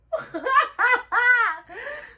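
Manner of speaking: high-pitched burst of hammed-up laughter; shrieky laughing outburst; loud high cackle. Acting out her reaction.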